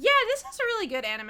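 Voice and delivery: high, quick gush; weird high-pitched voice. Real, not acted. Host praising the anime, accidentally high-pitched. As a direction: let the voice jump up high and hurry. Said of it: "that was a weird voice high pitch".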